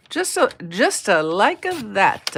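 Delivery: playful slip into an Italian accent. Real, not acted. Crafter showing viewers a step, in a slow Italian accent. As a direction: add Italian-style extra vowels, slowly. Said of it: "come up with an Italian accent".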